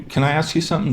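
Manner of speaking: high, friendly ask; casual and nice, no pressure; sorta nice. Shown as a demonstration. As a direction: ask lightly, just being nice. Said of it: "sounds like you're just being sorta nice".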